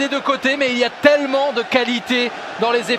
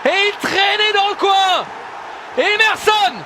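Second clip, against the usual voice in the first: excited sports commentary; 5 semitones higher than usual. Real, not acted. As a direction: shout the scorer's name with joy. Goal scored, commentator shouts.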